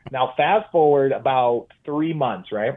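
ordinary delivery

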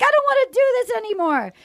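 high, fast imitated upset; mock tearful. Girlfriend giving up on the breakup talk, mock tearful and high-pitched. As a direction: mimic a girlfriend starting to cry. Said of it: "then she starts crying".

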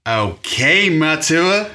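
a light put-on American drawl on Matua; mock American accent. Answering his host in a joking American accent. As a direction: play at sounding American. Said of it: "answer in American accent".

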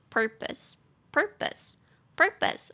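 "purpose" slowly, stress on the first syllable; slow pronunciation drill. Shown as a demonstration. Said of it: "两个音节".

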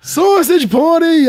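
slow, high, loud drawn-out yell of the title; hammed-up shout. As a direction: belt out Sausage Party like an announcer.

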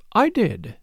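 mildly self-satisfied; complacent. A narrator's reading. Sister admits she told Poirot, complacent. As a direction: own up with smug satisfaction. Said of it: "said Caroline complacently".

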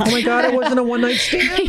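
high, laughing tease; laughing joke. Real, not acted. Teasing co-host about babies, laughing high. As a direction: toss it out high while laughing.

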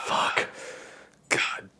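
low-volume, slow exhausted cursing; hushed, drawn-out resignation, word by word; imitated weary wife. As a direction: curse under your breath like a dreading wife. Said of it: "takes a deep breath. She's just like".

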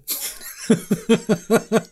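high burst of laughter. Real, not acted. Laughing at the joke.

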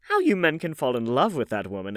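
exclaiming. A narrator's reading. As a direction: exclaim in lively disbelief. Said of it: "exclaimed the old lady".

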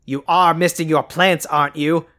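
ordinary talk, nothing marked

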